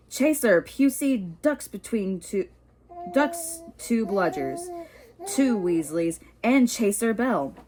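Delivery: sports commentary. Acted. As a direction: commentate the match live. Lee Jordan commentating.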